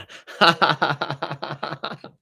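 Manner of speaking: high, rapid cackling laugh; real amused laughter. Real, not acted. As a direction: burst out laughing, long run of ha-ha. Laughs at a viewer's verdict.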